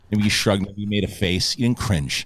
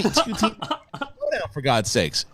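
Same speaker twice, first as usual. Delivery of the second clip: slow, laughing plea to slow down; slow it down, laughing and fed up; mock exasperated plea; 4 semitones higher, 5 dB quieter, slower than usual. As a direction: beg them with a laugh. Mock pleading with editors.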